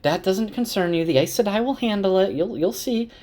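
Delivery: high-pitched, lightly acted woman's voice; fussing innkeeper.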